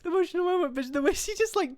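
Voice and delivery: high, squeaky talk through laughter; helpless laughter. Real, not acted. Player derailed in an emotional moment, laughing helplessly. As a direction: try to apologise while cracking up. Cracks up during an emotional scene.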